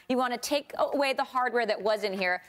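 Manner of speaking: ordinary talk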